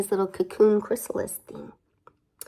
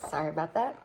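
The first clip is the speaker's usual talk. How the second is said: plain reading voice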